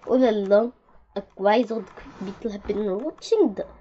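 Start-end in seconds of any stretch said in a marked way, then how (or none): none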